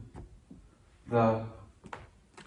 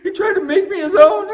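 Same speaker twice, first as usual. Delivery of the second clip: much higher, louder, with a mock sob and laugh; fake accusation; 7 dB louder than usual. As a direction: play the wronged wife crying falsely. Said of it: "She said".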